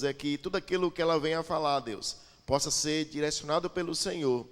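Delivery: ordinary delivery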